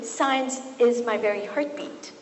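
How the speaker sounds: ordinary talk, nothing marked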